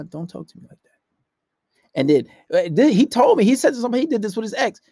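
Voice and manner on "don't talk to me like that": hushed, unmoved repeat; calm. Retold or imitated. Randy cutting off her protest, calm and hushed. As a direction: cut in very softly, staying calm. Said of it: "He'll be calm".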